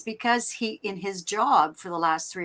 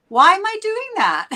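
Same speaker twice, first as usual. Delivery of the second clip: high, louder mock bafflement; self-questioning; 12 semitones higher, 6 dB louder than usual. Catching herself copying accents, high-pitched baffled self-questioning. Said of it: "I hear myself and I'm going".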